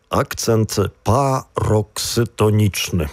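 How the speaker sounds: slow, measured enunciation of the term; careful sounding-out. Shown as a demonstration. Co-host naming the term for the partner, carefully sounded out. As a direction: take парокситонічний slowly, syllable by syllable.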